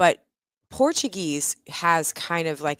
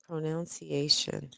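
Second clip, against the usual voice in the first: ordinary talk, nothing marked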